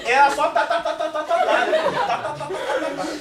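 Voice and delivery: mock tongue-tied babble, every syllable "tá"; imitated babbling speech. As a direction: rattle off "tá tá tá" like unintelligible speech. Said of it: "como esse menino era antes!".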